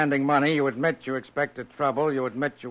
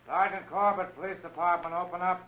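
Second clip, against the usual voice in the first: higher call, heard through a door; knock and announce; 5 semitones higher, 4 dB quieter than usual. Acted. Police at the door.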